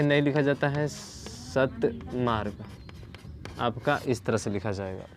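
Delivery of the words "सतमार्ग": slow spelled-out word. Shown as a demonstration. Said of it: "सतमार्ग ऐसे नहीं".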